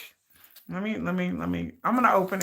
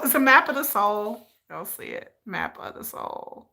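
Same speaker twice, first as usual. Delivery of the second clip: normal talking voice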